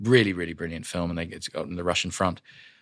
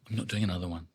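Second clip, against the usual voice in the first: muttered refusal; 2 semitones lower, 9 dB quieter, faster than usual. Retold or imitated. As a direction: mutter it to yourself. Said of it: "And I went".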